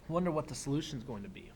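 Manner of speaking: ordinary talk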